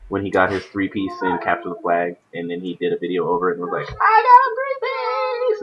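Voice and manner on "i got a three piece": imitated scream. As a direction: scream it like a hyped player bragging to camera. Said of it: "he's, like, screaming at the camera".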